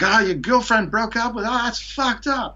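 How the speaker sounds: high, exaggerated Australian bloke voice; Australian accent. Imitating Australian guys reacting to his breakup. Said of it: "that was a pretty decent Australian accent there".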